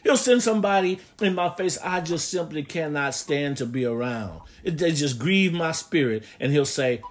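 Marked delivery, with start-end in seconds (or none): none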